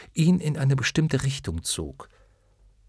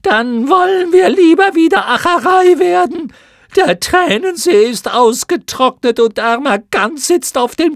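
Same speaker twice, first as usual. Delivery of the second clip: shrill moth voices; 11 dB louder than usual. Acted. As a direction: screech it as a chorus. Schlamuffen wollen zurückverwandelt werden. (schrille, sehr hohe Mottenstimmen)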